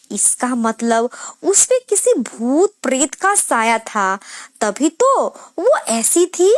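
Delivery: ordinary delivery, nothing marked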